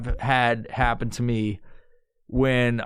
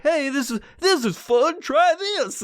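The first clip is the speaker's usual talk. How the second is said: cheerful box voice; 11 semitones higher than usual. Retold or imitated. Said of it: "It's like".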